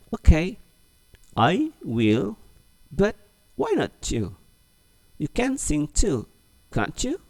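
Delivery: high, coaxing dialog voice; high coaxing voice, slowed for the class; model reading. Shown as a demonstration. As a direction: read it slowly for the class to repeat. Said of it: "Oke, ulang lagi ya".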